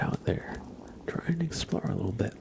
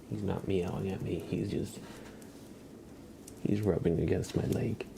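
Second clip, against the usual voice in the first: ordinary talk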